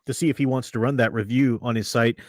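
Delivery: ordinary talk, nothing marked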